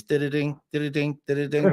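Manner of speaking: sing-song did-a-ding chime, rising at the end; door alarm chime. Retold or imitated. Trucker mimics his lorry's door alarm, sing-song chime. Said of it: "the first noise you hear in a daf".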